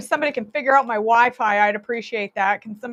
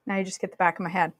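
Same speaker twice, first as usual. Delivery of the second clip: ordinary talk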